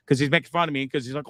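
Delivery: ordinary delivery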